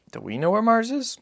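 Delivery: put-on high, light child's voice; a small boy asking his father; boy's voice. Narrator voices the boy asking Papa, high and childlike.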